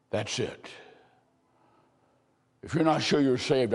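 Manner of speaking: ordinary talk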